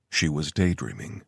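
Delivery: ordinary delivery, nothing marked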